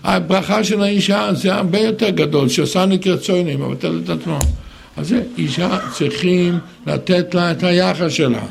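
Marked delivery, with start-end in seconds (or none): none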